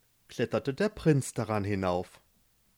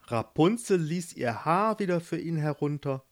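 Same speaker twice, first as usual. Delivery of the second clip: usual speaking voice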